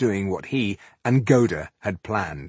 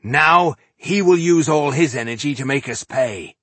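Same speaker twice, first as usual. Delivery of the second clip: louder, higher angry warning; angry snarl; 6 semitones higher, 5 dB louder than usual. A narrator's reading. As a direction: warn them in a dangerous temper. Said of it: "snarled Tungdil, sounding as dangerous".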